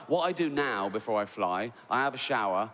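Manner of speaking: normal talking voice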